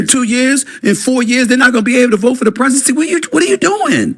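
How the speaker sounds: high, fast rising disbelief; outraged and baffled; incredulous. Retold or imitated. Outraged reaction to Oprah quote.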